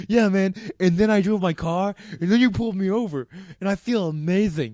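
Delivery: loud, loose mock drunk voice; imitating a drunk. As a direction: ham up a happy drunk. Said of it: "pretending to talk like the drunk guy".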